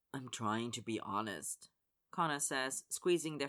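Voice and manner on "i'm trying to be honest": gentle remorse, lower register. A narrator's reading. Connor earnest.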